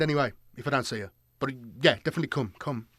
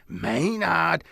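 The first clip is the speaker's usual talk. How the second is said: demon calling Maynard; 2 semitones higher than usual. Retold or imitated. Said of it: "when he's going".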